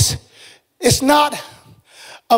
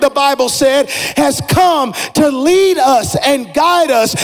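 preaching at full force; 3 semitones higher than usual. Acted. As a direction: preach it out to the whole room.

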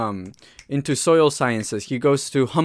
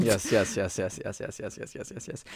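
rapid yeses trailing to near silence; fading repeated agreement; 4 semitones lower, 9 dB quieter than usual. Pretend. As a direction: keep agreeing as the voice sinks away. Mock agreement repeated, fading away.

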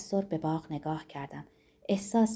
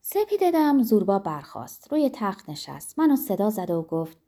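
plain reading voice

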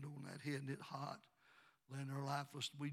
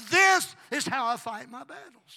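very loud, high, drawn-out declaration; triumphant preaching shout; 8 semitones higher, 18 dB louder, slower than usual. Real, not acted. Preacher declaring victory to the church, shouting. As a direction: shout it slowly, hitting THIS hard.

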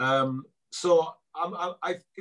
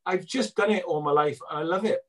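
normal talking voice

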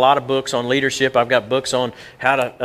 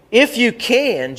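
"If you can" drawn out, higher and slow; stress on IF; 8 semitones higher, slower than usual. Shown as a demonstration. Preacher stressing Jesus' words to the church, slow and high. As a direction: lean hard on "If you can" and stretch it. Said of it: "Jesus said".

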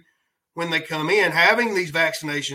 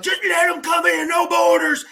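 mocking open-borders voice; 10 semitones higher than usual. Mocking the open-border crowd with a high yelling voice. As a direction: squawk it like the other side. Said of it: "The ones that are like".

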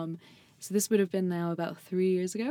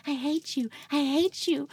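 high, louder play-tantrum; mock angry; 9 semitones higher, 4 dB louder, slower than usual. Hitting the friend who carries her, mock angry. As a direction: imitate your furious younger self in a high voice. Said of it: "I remember being so angry".

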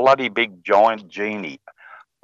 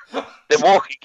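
giggling through the words; can't keep a straight face telling it; breaking into laughter at his own joke; laughing; 6 semitones higher than usual. Real, not acted.

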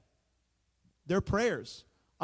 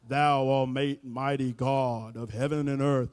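slow, lower, grand churchy prayer voice; mock prayer voice; 4 semitones lower, 5 dB louder, slower than usual. Shown as a demonstration. Pastor mocking showy prayers, slow grand prayer voice. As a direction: put on a pompous holy tone. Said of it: "But I talk like this".

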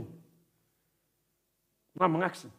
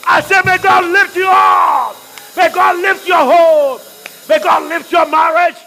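higher and louder, slow, fervent shouted prayer; preaching at full force; 10 semitones higher, 11 dB louder than usual. Acted. Pastor to congregation, praying over them, shouted preaching at full force.

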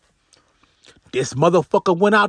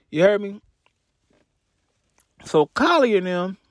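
ordinary talk

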